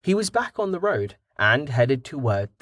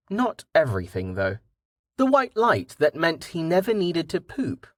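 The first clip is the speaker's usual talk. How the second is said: usual speaking voice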